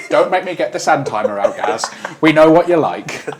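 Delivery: loud, playful scolding; mock threat. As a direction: tease him with a fake warning. Mock threat to slow player.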